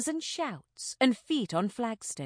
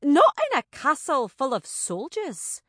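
indignant raised retort; angry; 5 semitones higher, 6 dB louder than usual. Acted. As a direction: throw it back at him furiously. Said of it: "she said angrily".

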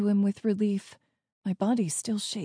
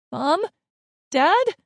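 high, loud questioning cries; yelled call; 10 semitones higher, 7 dB louder than usual. A narrator's reading. Children behind the bush calling parents, anxious yell. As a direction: call out for help, rising. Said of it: "we both yelled out".

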